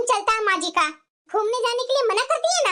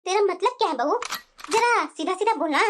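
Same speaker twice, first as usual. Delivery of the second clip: lower, stern saas demanding an answer; stern mother-in-law; 6 semitones lower than usual. Acted. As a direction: challenge the daughter-in-law coldly. Mother-in-law challenges her. (बहू से सीधा जवाब माँगती सख्त सास)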